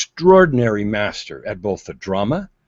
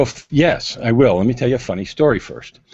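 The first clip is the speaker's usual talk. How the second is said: ordinary talk, nothing marked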